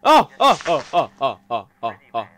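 string of short "oh!" cries; rapid-fire gasped exclamations, first ones loudest; startled yelps. Real, not acted. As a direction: yelp over and over, easing off. Repeated startled cries at game.

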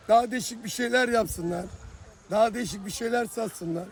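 ordinary talk

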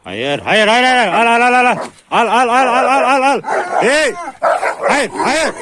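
high, loud rapid commands. Real, not acted. Farmer holding animals back from the food, loud high shouts. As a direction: shout them back, fast.

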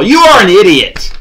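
loud, exasperated shout at the coach; angry outburst. Real, not acted. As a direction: yell it at Arthur Smith in disgust. Ranting at the head coach.

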